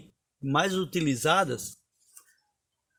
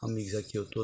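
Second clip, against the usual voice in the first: lower, softer murmur of Amigos, aqui eu tô; hushed, lower run-through of Amigos, aqui eu tô; soft recital; 4 semitones lower, 8 dB quieter, much faster than usual. Shown as a demonstration. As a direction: read Amigos, aqui eu tô quietly to yourself. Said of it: "Por exemplo".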